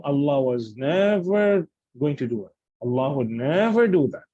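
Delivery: NEVER drawn out long for emphasis; emphatic negation. Shown as a demonstration. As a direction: stretch "never" slowly to make the negation absolute. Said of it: "Emphatic negation".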